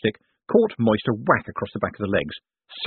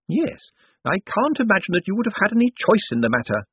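put-on high-pitched, wry old lady; wry elderly woman's voice, pitched well up; Missus Lavish; 11 semitones higher than usual. A narrator's reading. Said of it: "said Missus Lavish".

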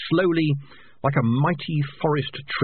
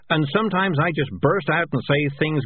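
nervous desperate explaining; 6 semitones higher than usual. Acted. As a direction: rush through the excuse. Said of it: "said Morris desperately".

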